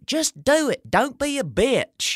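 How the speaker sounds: high, squeaky yelling voice, drawn out; cracking teenage skater yell. Retold or imitated. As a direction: yell it like a squeaky fourteen-year-old. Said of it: "I can't have my voice sound like".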